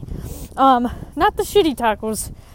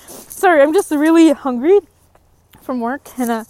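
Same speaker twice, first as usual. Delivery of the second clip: ordinary talk, nothing marked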